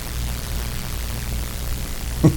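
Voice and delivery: breathy chuckle; soft laugh. Real, not acted. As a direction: laugh quietly at the joke. Preacher laughs at own bit.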